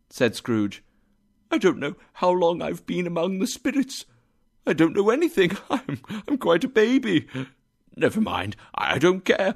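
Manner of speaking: fast, high, giggly; giddy laughter. A narrator's reading. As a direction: laugh through giddy confusion.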